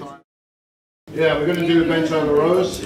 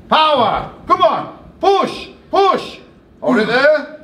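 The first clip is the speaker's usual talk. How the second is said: high, loud gym coaching shouts; coach shouting cues; 9 semitones higher, 3 dB louder, slower than usual. Real, not acted. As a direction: drive the lifter through the rep. Coach cueing a lift.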